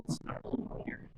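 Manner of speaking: breathy unvoiced whisper. Real, not acted. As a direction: whisper the weather report. Unvoiced whisper over wind.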